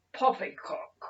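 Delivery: sharp, loud, high dismissive snap; a loud, high, scornful snap; snapped. A narrator's reading. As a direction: bite off the word in contempt. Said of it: "snapped a voice".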